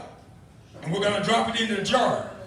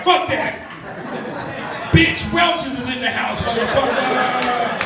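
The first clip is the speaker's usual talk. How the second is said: rowdy mock shout; 2 semitones higher, 4 dB louder, slower than usual. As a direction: bellow it like a rowdy crew storming in. Imagined rowdy crew shouting.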